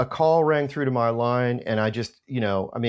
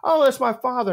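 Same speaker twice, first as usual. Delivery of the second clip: a high, casual lilt; breezy reply; 11 semitones higher, 3 dB louder than usual. Retold or imitated. The famous daughter casually confirms her father, high and breezy. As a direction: toss it off lightly. Rafaela voiced in a story.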